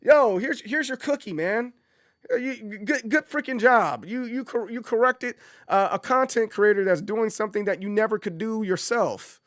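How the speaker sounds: high mock praise, sarcastic; mocking congratulations to a pedantic commenter. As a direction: hand them a sarcastic gold star. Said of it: "but it's like".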